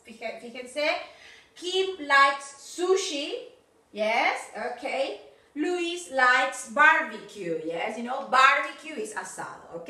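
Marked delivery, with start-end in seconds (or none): 1.6-3.5 Lucy likes dim sum said slowly, S of likes clear; teacher models the pronunciation; careful pronunciation. Shown as a demonstration. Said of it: "ojo la pronunciación". 5.6-7.6 Lucy likes dim sum said slowly, S of likes clear; teacher models the pronunciation; careful pronunciation. Shown as a demonstration. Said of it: "ojo la pronunciación".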